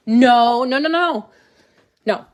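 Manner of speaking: sharp high loud no; loud protest. Real, not acted. Child coming back, loud protest.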